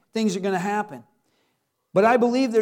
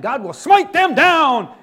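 louder, slow, hammed-up vengeful cry; a comic holy-war yell, louder and slowed; mock smiting shout; 4 semitones higher, 8 dB louder, slower than usual. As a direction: bellow it like a mock avenger. Mock vengeful outburst in sermon.

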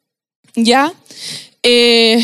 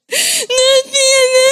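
put-on high, wailing little-boy protest; mock child crying, much higher; imitating a crying child; 11 semitones higher, much slower than usual. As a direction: whimper it like a kid about to cry. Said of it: "se puso a llorar".

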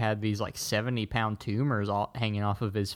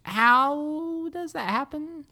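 baffled; slower than usual. Real, not acted. Baffled question about the movie.

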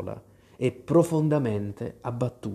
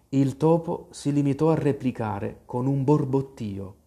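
ordinary delivery

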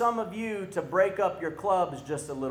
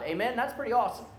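ordinary talk, nothing marked

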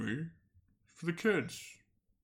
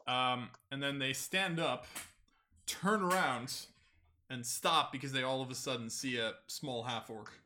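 usual speaking voice

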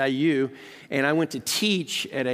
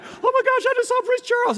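much higher, louder excited squeal; starstruck squeal; 5 dB louder than usual. Retold or imitated. Retelling meeting the prince, giddy high squeal. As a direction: gush like a starstruck fan. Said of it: "I was like".

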